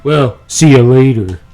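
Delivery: booming game character voice; loud character voice. Acted. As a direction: play the shady informant, loud and bold.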